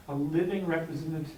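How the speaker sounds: normal talking voice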